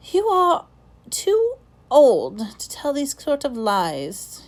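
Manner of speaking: plain reading voice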